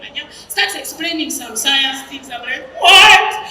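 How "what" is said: loud, higher mock disbelief; hammed-up loud "what"; shocked outburst. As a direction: blurt it out in exaggerated shock. Acted shock at his sad news.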